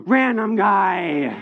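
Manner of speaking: gasp, then a loud drawn-out cry; comic dread, every syllable dragged out; gasping realization. Retold or imitated. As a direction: stretch it out slowly in mock horror. Panicked dawning realization, acted gasp.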